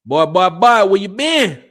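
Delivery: put-on loud, high country-boy greeting. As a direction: holler it like a country cousin. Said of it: "Country said".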